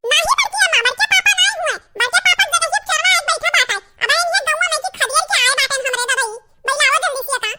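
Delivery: put-on a little boy yelling to beat the thief; excited small boy. Boy yells to his grandmother to beat the thief, high excited shouting.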